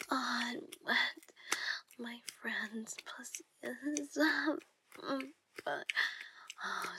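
sultry erotic whisper; seductive whisper. Acted. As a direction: whisper it to a lover in the dark.

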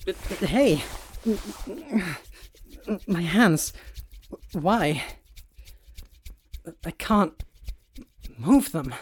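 sudden alarmed protest; startled alarm. Acted. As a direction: caught off guard as his hands are bound. Hands suddenly tied, alarmed.

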